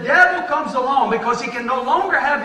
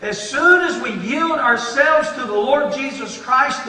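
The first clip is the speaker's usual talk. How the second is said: usual speaking voice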